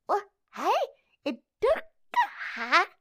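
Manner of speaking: high, loud cheerful dragon greeting; excited dragon puppet. Acted. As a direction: pop in as a bubbly little dragon.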